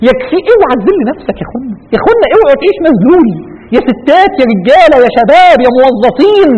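high, loud, rousing preaching; fervent exhortation. Real, not acted. Preacher calling women, men, youth and workers, fervent exhortation. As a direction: call out to everyone at full force. Rousing appeal to all listeners.

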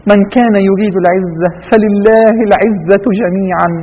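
slow, full-voiced verse recitation; Quranic recitation. Real, not acted. Preacher reciting a verse on honour to listeners, measured recitation. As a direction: recite the verse slowly, drawing out the vowels.

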